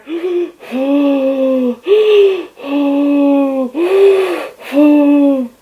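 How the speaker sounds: long run of loud, high huffing breaths; drawn-out mock hyperventilation, breath after breath; imitated hyperventilating. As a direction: keep gasping hard on the gas. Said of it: "And then I just kept going".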